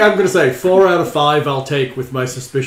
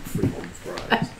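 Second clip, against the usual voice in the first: drawn out slowly, rising at the end; slow, amused drawl; 5 semitones higher, 8 dB quieter, much slower than usual. Pretend. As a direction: stretch each word out in amusement. Slow, drawn-out repeat of the joke.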